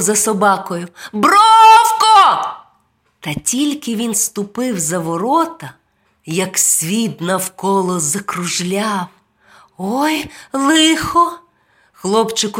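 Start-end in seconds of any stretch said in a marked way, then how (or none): 1.1-2.5 high, drawn-out shout; loud boyish holler; calling the dog. A narrator's reading. Boy calls after his running dog. 9.8-11.4 put-on dismayed boy. A narrator's reading. Boy dismayed by spinning world.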